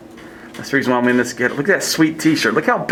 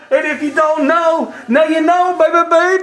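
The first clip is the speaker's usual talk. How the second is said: much higher, hammed-up hype voice; hype-man falsetto; 13 semitones higher than usual. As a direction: pump it up like a wild emcee.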